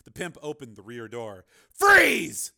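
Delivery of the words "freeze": sudden high, loud shout; snapped command. Acted. Steele halting the pimp at gunpoint, snapped shout. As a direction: snap it out at full force. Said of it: "snapped Steele".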